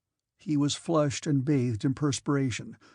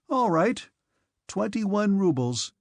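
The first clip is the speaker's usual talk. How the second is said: Dolokhov; 5 semitones higher than usual. A narrator's reading. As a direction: spell out the sum with relish. Dolokhov names the sum.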